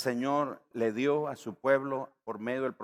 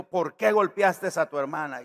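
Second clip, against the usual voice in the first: angry father; 6 semitones higher, 9 dB louder than usual. Retold or imitated. Father confronting son with belt.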